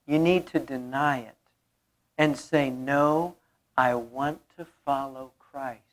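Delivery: soft intimate aside to the congregation; quiet. Real, not acted. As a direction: drop to an intimate hush. Sudden soft drop in sermon.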